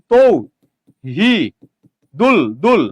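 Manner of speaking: তৌ, হি, দুল each said alone; long pauses between তৌ, হি and দুল; syllable-by-syllable. Shown as a demonstration. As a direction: dictate তৌ হি দুল piece by piece. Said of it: "তাহলে আপনি কি বলেন?".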